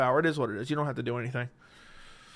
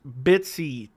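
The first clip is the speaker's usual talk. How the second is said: ordinary delivery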